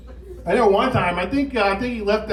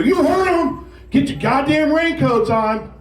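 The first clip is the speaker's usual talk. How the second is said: commander yell; 9 semitones higher, slower than usual. Retold or imitated. Company commander yelling at recruits to get raincoats on. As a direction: bark it at the recruits like a drill sergeant.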